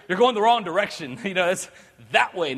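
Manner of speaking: normal talking voice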